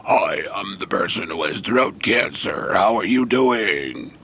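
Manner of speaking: slow, droning electrolarynx impression; electronic voice box. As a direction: imitate a throat patient's robotic voice device, dragging the words out. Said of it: "one of those electronic voice thingies, and their voice is all like".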